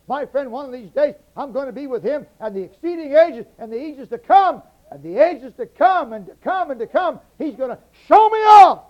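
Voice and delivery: building to a shouted climax, "show me off" at the top of the voice; preaching at full force. Acted. Preacher to congregation, rising to a full shout.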